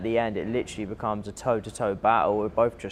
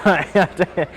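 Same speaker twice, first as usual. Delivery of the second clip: laughing; 8 semitones higher, 9 dB louder, slower than usual. Real, not acted. Laughing at her own story.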